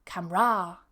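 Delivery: CAMERA said as kam-RAH, stressed open last syllable; mispronounced camera. Shown as a demonstration. Teacher showing learners a wrong Camera, mispronounced as kam-RAH. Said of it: "If I say".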